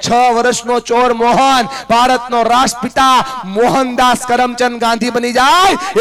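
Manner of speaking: loud, high, impassioned sermon voice; a speaker thundering about values from the stage; fiery preaching. Acted. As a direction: preach it to the crowd at full force. Storytelling at full preaching force.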